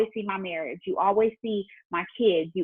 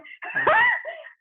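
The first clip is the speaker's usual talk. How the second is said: high burst of laughter. Real, not acted. Laugh after mimicking husband.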